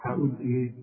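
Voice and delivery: ordinary talk, nothing marked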